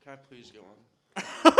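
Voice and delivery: ordinary talk, nothing marked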